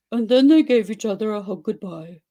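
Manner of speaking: usual speaking voice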